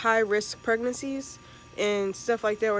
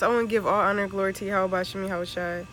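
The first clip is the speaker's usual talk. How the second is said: ordinary delivery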